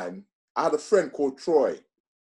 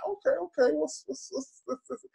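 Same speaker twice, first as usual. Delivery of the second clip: much higher, stunned and stammering; baffled disbelief; 5 dB quieter than usual. Retold or imitated. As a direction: reel back in confused surprise. Retelling reaction to strange lecture ideas.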